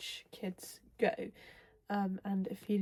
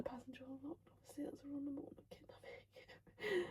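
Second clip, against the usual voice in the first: hushed, high, whispery and about to laugh; suppressed giggles; 6 semitones higher, 12 dB quieter, faster than usual. Real, not acted. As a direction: squeeze it out before the laugh breaks. Giggling while reading the book.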